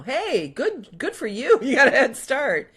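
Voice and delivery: high, loud cheering with a laugh; excited praise. Real, not acted. Cheering a viewer's decluttering.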